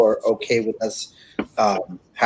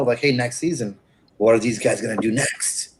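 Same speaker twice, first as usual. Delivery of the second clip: ordinary talk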